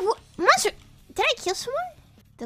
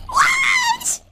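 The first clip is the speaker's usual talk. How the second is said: very high held yell; shocked shout. Real, not acted. Streamer stunned by the game, high shocked shout. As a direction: shriek in disbelief.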